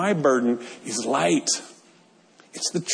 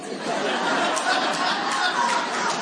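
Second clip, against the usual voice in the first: quiet huffed laugh; breathy laugh at the joke; 5 dB quieter than usual. Real, not acted. Laughing at coworker's deadpan deadline joke, soft huffing laugh. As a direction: laugh it out, caught off guard.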